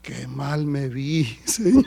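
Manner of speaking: slow, drawn-out sheepish confession; acted remorse. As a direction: sink into mock regret before God. Acted regretful prayer after the quarrel. (arrepentimiento actuado, arrastrando las palabras)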